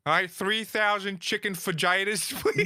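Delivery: high, slow cartoon voice; Family Guy order. Quoting a cartoon fajita order, high put-on voice. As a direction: order high and drawn-out, breaking into laughter. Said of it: "reminds me of the Family Guy episode".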